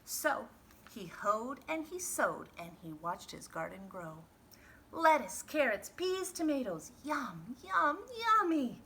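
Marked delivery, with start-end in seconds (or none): 4.9-8.8 high, loud listing of treats; delighted farmer. Acted. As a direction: savour each word with glee. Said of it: "said Mister McGreely".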